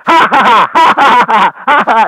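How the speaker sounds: loud, high mad-scientist cackle; maniacal mad laugh. Shown as a demonstration. Actor shows laugh the director asked for, maniacal mad laugh. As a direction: rattle off a string of wild, pealing ha-has. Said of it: "Give me a maniacal mad laugh".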